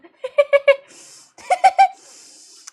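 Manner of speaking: long high loud burst of laughter. Real, not acted. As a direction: crack up for real. Streamer laughing at the fly.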